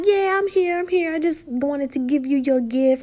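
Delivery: high playful voicing of the husband; mock husband. Husband answering his wife's call, light mock voice. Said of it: "He's like".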